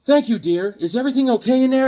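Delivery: high, fast mother; quick sweet mom. Retold or imitated. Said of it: "he's like".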